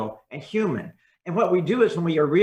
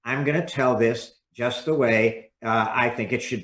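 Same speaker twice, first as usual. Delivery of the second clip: constricted monotone; 4 semitones lower than usual. Shown as a demonstration. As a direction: sound like you're reading carefully to avoid mistakes. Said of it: "you see what's happening to my voice".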